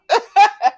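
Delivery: short high burst of laughter; quick, high, tickled laugh; amused laugh. Real, not acted. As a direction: crack up briefly at the story. Amused by the retold reaction.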